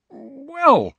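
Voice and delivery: high, loud drawn-out "well"; startled, high-pitched recovery; taken aback. Acted. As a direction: stall after an unpleasant surprise. Harry recovers from unpleasant surprise.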